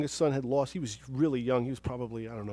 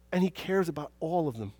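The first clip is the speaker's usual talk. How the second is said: ordinary talk, nothing marked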